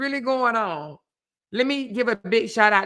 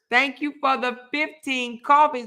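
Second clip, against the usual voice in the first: normal talking voice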